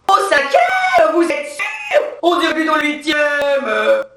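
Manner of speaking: high, drawn-out incredulous shout; scolding nurse. Acted. Nurse doubting a pregnant woman's month, loud incredulous scolding. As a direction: shriek it slowly in disbelief as the nurse. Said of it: "j'entends l'infirmière engueuler la femme enceinte".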